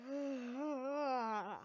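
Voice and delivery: long tired yawn. Real, not acted. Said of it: "Sorry for yawning".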